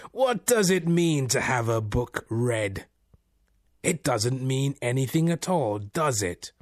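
older man imitation. A narrator's reading. Laughing voice questions the meaning of books, as an older man. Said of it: "as if imitating the voice of an older man".

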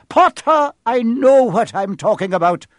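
curt. Acted. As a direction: cut him off sharply. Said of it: "she said shortly".

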